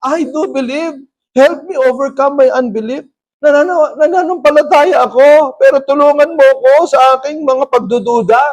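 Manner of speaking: high, loud acted declaration; exclaiming father. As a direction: cry out belief and plea as the father. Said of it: "the boy's father exclaimed". (bulalas ng tatay, malakas at mataas)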